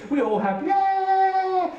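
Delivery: high, bright-voiced yay; a quick pretend whoop of joy; mock cheer. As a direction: burst out in a playful cheer. Mock cheer over English holidays.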